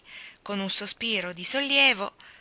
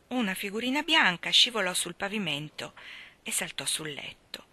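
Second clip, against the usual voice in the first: usual speaking voice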